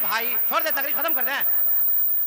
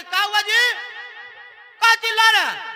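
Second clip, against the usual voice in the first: high-pitched irritated wife's retort; mimicked exasperated housewife; annoyed wife; 11 semitones higher, 7 dB louder than usual. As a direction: call back crossly in a high voice. Wife answers husband from inside room.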